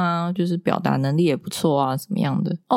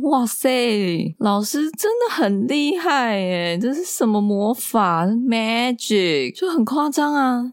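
higher, slow and wondering; amazed; 6 semitones higher, slower than usual. Retold or imitated. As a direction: gasp in delighted disbelief. Amazed son wore glasses all day.

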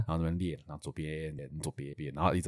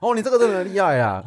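old man praising the rider, hammed up high; imitating the old man; 8 semitones higher than usual. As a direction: praise like an impressed old uncle. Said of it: "我现在的口气就是他的口气".

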